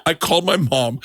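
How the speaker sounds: usual speaking voice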